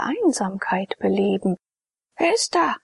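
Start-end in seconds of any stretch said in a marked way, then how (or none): 2.2-2.8 high, startled call into the dark; frightened call. Acted. Trapped priest hears his name and calls out, high and frightened. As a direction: call out, afraid of a ghost. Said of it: "rief er".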